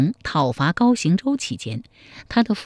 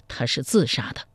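哽咽; 4 semitones lower, 6 dB quieter than usual. A narrator's reading. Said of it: "哽咽着说".

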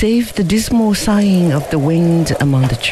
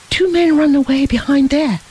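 crying out; 9 semitones higher than usual. A narrator's reading. Boy pointing his companion to fallen trees, crying out. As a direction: raise the pitch as if pointing out runaways. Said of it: "cried he".

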